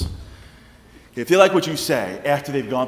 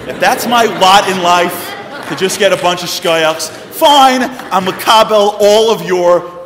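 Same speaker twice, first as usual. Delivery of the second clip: loud, exasperated acted outburst; mock defiant rant; 4 semitones higher, 11 dB louder, slower than usual. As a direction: give up in a loud comic huff. Acted defiant rant after getting no amens.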